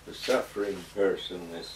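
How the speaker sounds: soft, low-key and under-projected; too quiet. Real, not acted. Speaker explaining Church doctrine too softly for the interviewer, quiet. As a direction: say it quietly, barely carrying to the listener. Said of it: "Can you talk louder".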